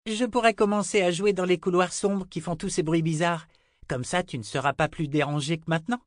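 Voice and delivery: put-on high-pitched child's voice; high, eager girlish chatter; little girl Rachel, eager. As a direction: pipe up like a keen small girl with a plan.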